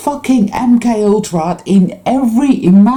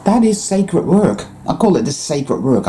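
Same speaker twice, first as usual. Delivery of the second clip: ordinary talk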